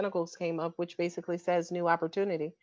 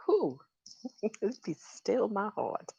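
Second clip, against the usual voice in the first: mock swoon; 6 dB quieter, slower than usual.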